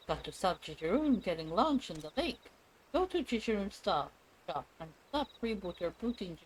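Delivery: sing-song creature voice with leaping pitch; animated, swooping game-creature voice; excitable trader creature. Acted. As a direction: play a fretful little trader, pitch jumping up on key words.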